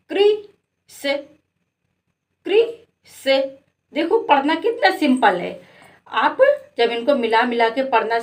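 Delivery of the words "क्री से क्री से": क्री and से read apart with pauses; syllable by syllable. Shown as a demonstration. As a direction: break the word into क्री... से for the kids. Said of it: "का में री की मात्रा क्री".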